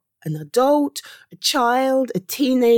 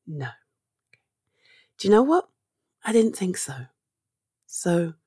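ordinary delivery

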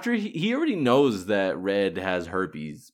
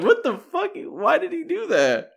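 much higher, louder stunned disbelief; high startled what the fuck, slow on the second line; shocked viewer; 4 dB louder, slower than usual. Retold or imitated. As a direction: shriek of disbelief at the screen. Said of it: "you're like".

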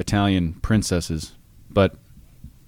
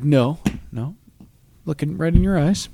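higher, mock-innocent denial; retold denial; 7 semitones higher than usual. Retelling to his friend his innocent denial to Andrea, pitched up. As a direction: protest innocence a bit too eagerly. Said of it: "I was like".

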